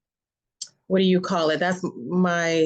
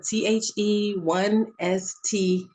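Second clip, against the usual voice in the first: spelled out slowly; slower than usual. Shown as a demonstration. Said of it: "the First Lady Erica".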